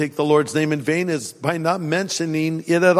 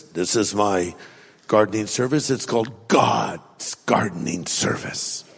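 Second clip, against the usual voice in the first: business owner pitch; 10 semitones lower, 4 dB quieter than usual. Retold or imitated.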